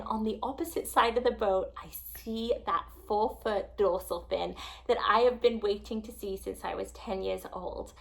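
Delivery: ordinary delivery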